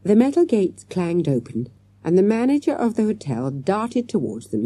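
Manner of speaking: plain reading voice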